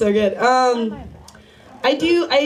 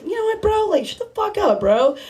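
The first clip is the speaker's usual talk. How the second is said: higher bro voice, brushing someone off; mock bro voice; 9 semitones higher than usual. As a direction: playfully tell a buddy to shut it, bro-style. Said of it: "I just been trying to tell him".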